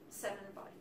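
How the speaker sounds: soft drop after the storm; hushed ending. Acted. Poet reveals the flood's death toll, hushed and grave.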